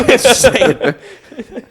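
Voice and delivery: slow, high through laughter; laughing. Real, not acted. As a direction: stretch it out laughing.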